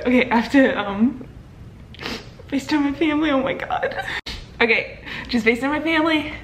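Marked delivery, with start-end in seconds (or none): none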